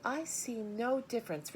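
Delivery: high, stubborn reply; girl defies her father; defiant girl. A narrator's reading. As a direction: refuse to give in. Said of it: "replied Fern".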